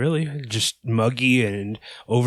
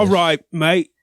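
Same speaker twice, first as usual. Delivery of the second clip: loud, high mock Australian; punchy, botched Australian that sounds English; attempted Australian accent; 6 semitones higher, 5 dB louder than usual. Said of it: "English guy doing an Australian accent".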